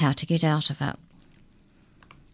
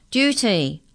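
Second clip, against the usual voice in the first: raised, high-pitched official call; 7 semitones higher, 4 dB louder than usual. A narrator's reading. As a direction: call it out across the hallway. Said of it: "called out".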